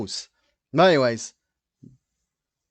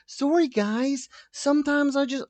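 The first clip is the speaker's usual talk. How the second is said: Kate Middleton; 12 semitones higher than usual. Acted. Host voicing Kate's apology, high put-on voice. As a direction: badly imitate a royal apology, pitched way up. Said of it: "that's not the way she talks".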